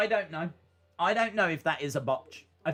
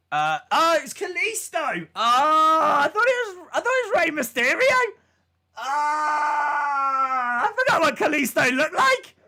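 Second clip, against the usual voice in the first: loud, high, disbelieving outburst; shocked shout; 8 semitones higher, 6 dB louder than usual. Real, not acted. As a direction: yell it out in sheer disbelief. Shocked reaction to wrestler reveal.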